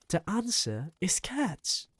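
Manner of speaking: put-on high-pitched eager boy's cry; excited shout. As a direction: announce the answer with glee. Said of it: "I shouted".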